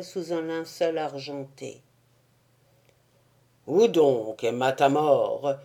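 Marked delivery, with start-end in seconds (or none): 3.7-5.5 lower, louder character voice; Blasius voice. A narrator's reading. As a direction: voice a gruff comedian. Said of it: "dit Blasius".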